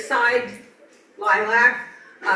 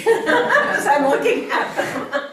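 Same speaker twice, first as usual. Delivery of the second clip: high, loud, slowed by real laughter; cracking up at one's own slip; laughing while talking; 7 semitones higher, 6 dB louder, slower than usual. Real, not acted. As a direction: laugh right through the words.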